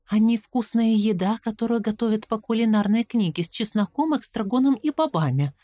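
put-on anxious little girl asking; girl's voice. A narrator's reading. Caroline asks. (голос встревоженной девочки)

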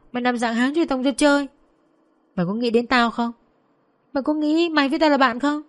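high-pitched, wounded accusation; angry reproach. Acted. Tú asks Vân if she ever counted as a friend, angry reproach.

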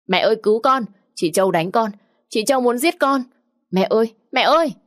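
high, loud panicked cry for mother; screaming for help. Acted. Stepdaughter screams to her mother while being dragged out, panicked loud cry. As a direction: shriek for mom as if being dragged off. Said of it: "trong tiếng la hét thất thanh".

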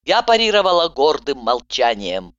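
usual speaking voice

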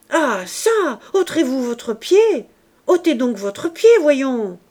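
put-on a small child trying to sound menacing; irritated, almost threatening. A narrator's reading. Irritated little boy demanding the man lift his foot, almost threatening. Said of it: "irrité ... presque menaçant".

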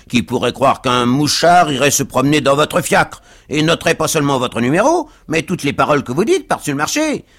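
fast, loud, high tirade; aggrieved cab driver. A narrator's reading. Cab driver denounces the passenger to the crowd, outraged. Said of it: "poursuivit le cocher à l'adresse de la foule".

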